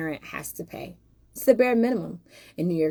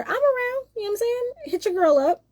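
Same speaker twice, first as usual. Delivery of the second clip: much higher, sing-song friendly offer; playful invite; faster than usual. Real, not acted.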